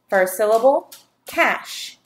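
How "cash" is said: ordinary talk, nothing marked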